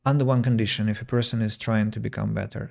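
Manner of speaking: usual speaking voice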